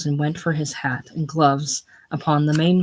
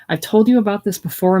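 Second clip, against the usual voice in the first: irritable reproof; 5 semitones higher, 5 dB louder than usual. A narrator's reading. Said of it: "irritably broken by a reproof".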